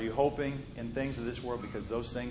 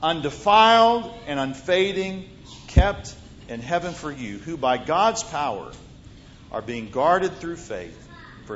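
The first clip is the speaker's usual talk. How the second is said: scripture reading; 2 semitones higher, 10 dB louder than usual. Acted. Preacher reads scripture aloud.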